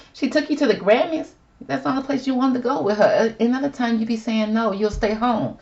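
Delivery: high-pitched incredulous telling-off; mock scolding. Scolding Ben over his complaint.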